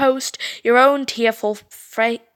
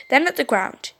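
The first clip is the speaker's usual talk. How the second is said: usual speaking voice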